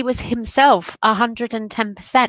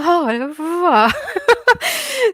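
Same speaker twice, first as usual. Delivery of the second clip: a breathy, high-pitched laugh; high emotional laugh; 10 semitones higher than usual. Real, not acted. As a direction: laugh out, half overcome. Laughs after emotional line.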